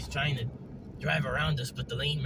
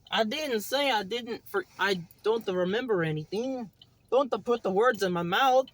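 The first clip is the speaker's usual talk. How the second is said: lightly put-on Mario-like voice, protesting; mild indignation in a lilting character voice; indignant Hopalong; 6 semitones higher, 3 dB louder than usual. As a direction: push back as a miffed cartoon character.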